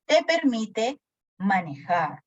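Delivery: ordinary talk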